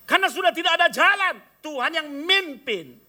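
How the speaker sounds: high, loud, fast then slowing hard on the last words; preaching climax; fervent preaching. Acted. Preacher climax on God leading.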